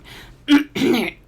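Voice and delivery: loud pointed ahem; throat clearing. A narrator's reading. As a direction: clear the throat to get attention. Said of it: "Paralee clears her throat".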